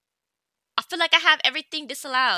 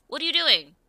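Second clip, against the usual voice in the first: usual speaking voice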